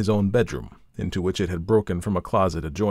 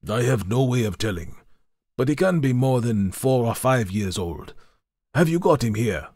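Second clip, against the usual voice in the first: a lightly raised, hint-of-a-sailor voice; assured sailor; 5 semitones higher, 3 dB louder than usual. A narrator's reading. Sailor answers Dupin.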